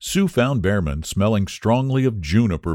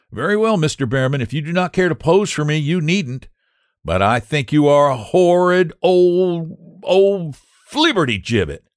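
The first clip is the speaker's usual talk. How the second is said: fast, high, then louder insult; indignant young Sue; 9 semitones higher, 4 dB louder than usual. Acted. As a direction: snap back at the stubborn old man, quickly. Sue's voice in the story.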